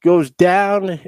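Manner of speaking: badly faked Texas accent; attempted Texas accent. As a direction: draw it out in a bad Texan voice. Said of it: "I'm trying to do a Texas accent".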